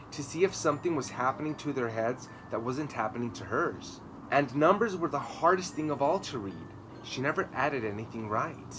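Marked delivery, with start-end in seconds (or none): none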